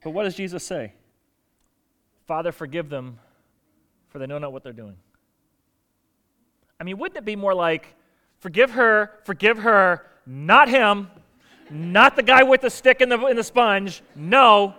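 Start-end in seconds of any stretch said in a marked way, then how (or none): 2.2-5.0 soft, low, gentle plea; Jesus praying softly on the cross; tender forgiveness. Retold or imitated. 8.4-14.7 loud, higher, heated list of who not to forgive; an exasperated Jesus refusing forgiveness; mock angry outburst. Imagined angry Jesus picking targets.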